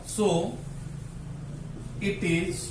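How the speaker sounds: slow, piece by piece with long pauses; dictation. Real, not acted. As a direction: dictate it slowly for note-taking. Dictates sentence for students to write.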